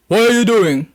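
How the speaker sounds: loud, booming voice from the bushes; a hidden stranger calling out, loud; deep voice. Retold or imitated. A voice in the woods startles the narrator, booming. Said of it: "I heard this deep ass voice say".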